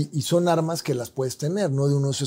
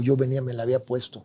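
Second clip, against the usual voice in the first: ordinary talk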